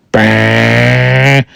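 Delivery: long, steady "brrr" noise; imitated motor buzz. Storyteller imitating what was heard on deck, droning buzz. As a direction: mimic an approaching engine with the voice. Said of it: "I hear a".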